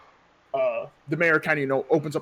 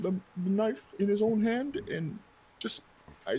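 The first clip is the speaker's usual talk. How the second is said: a lightly voiced wife, higher and softer, near tears; a hint of a tearful widow; grieving widow; 5 semitones higher, 5 dB quieter, slower than usual. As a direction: tell it slowly as if about to break.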